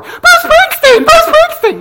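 very high, loud fangirl shriek; little-girl squeal. Acted. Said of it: "I'm like a little girl".

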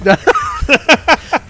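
loud high-pitched burst of laughter; embarrassed loud laugh at being told to show the tattoo. Real, not acted. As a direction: break into a real high laugh. Asked to show his butt tattoo.

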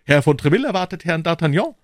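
loud, fast announcement; interrupting lackey. A narrator's reading. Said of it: "unterbrach sie der Lakai".

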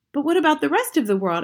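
high, loud urgent question; loud boyish protest; cried out. A narrator's reading. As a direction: demand it of grandmother. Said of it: "I cried".

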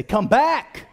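loud, emphatic call; like you mean it. Shown as a demonstration. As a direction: yell it with conviction. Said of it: "say it like you mean it".

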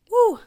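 loud, high-pitched "woo"; real whoop of awe; excited whoop. Real, not acted. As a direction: let out one sudden, high whoop. Reacts to Jesus's powerful line.

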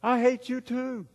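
high, slow, distant echo voice. Acted. Canyon voice answers the angry boy, distant echo. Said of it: "And the voice said".

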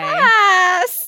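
high, loud laughing pass; laughing shout. Real, not acted. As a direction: blurt it out through laughter.

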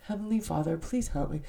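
soft, mild mock bedtime-style prayer; nice little prayer. Host imitating a nice little prayer, soft and tame. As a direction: pray it politely and quietly, without urgency. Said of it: "not just a nice little prayer".

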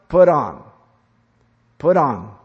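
loud barked command; commanding order. Acted. Preacher ordering believers like a general, loud command.

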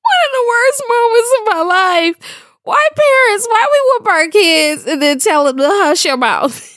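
high-pitched, helpless real laughter through the words; talking through a laughing fit. Real, not acted. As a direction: squeeze the words out while cracking up. Cracks up recalling the whooping.

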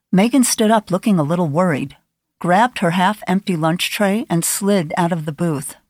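plain reading voice

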